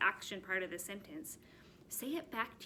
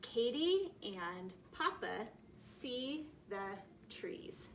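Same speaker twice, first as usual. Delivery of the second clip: slow, word-by-word reading for first graders; slow reading aloud; much slower than usual. Shown as a demonstration. Teacher reading slowly to first graders, word by word. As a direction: read it out slowly for the kids to follow. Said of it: "go ahead and read along with me".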